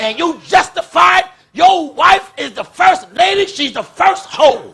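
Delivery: fiery shouted preaching. Acted. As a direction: preach it hard to the pews. Preaching to the congregation.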